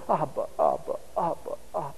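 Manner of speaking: put-on soft, low child's murmur; hushed, acted clinging toddler; murmured in father's ear. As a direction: say it softly, close into Daddy's ear. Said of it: "little mouth right in the ear of his father".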